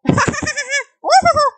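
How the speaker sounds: high, loud manic clown cackle; loud Joker laugh. Acted. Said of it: "suddenly Arthur starts to laugh. Loud".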